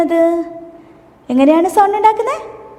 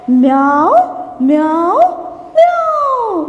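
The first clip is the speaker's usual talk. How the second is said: cat meow; 2 semitones higher, much slower than usual. Shown as a demonstration. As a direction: mimic a kitten crying for children. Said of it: "പൂച്ച കരയുന്നത് എങ്ങനെയാണ്?".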